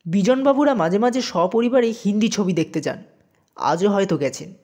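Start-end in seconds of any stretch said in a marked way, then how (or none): none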